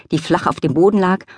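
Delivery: ordinary talk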